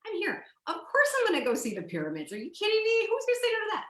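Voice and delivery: high, eager, can't-believe-it voice; retold excitement. Telling the host how she reacted to seeing Egypt, high and eager. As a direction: gush like a thrilled young tourist.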